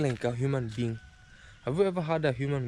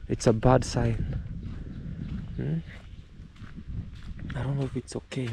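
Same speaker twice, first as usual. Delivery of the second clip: usual speaking voice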